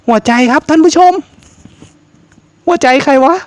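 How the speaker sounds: high, rattled address to the viewers; rattled horror streamer; startled host. Real, not acted. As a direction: blurt it out to the audience, shaken.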